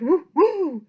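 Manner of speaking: loud, high barking "woo, woo"; dog bark. Acted. The daunted dog barks at the sneeze, loud bark. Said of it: "the dog who barked".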